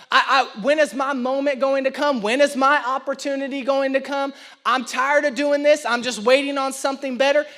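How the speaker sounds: preacher telling the congregation about Elisha, rising preaching. Acted. Drive the sermon with full force.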